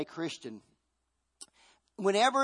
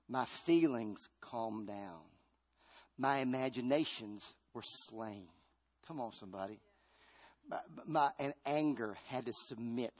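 calm and soft; 4 semitones lower, 7 dB quieter than usual. Acted. Preacher calming, slowing down.

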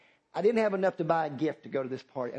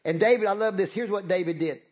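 loud, high preaching at full force; rousing pulpit preaching; 6 semitones higher, 5 dB louder than usual. Acted. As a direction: preach it big to the congregation.

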